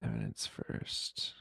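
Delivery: slow, deep breathy whisper; low whisper. Pretend. Typing his handle into search.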